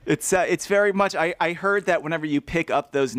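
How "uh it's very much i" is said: ordinary talk, nothing marked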